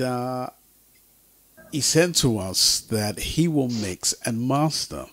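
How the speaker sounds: ordinary delivery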